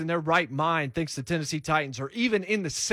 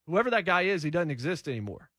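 usual speaking voice

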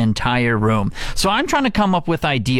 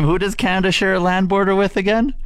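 teasing repeat; 6 semitones higher, faster than usual. Shown as a demonstration. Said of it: "Yeah, one more time".

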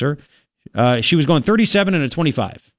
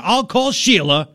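mock quote; 5 semitones higher, slower than usual. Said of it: "Do you hear?".

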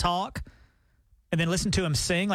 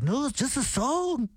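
slow, higher mock English accent; drawn-out, badly faked English accent; 6 semitones higher, slower than usual. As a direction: patiently explain in a hammed-up English accent. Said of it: "He's like".

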